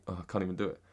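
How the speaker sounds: ordinary delivery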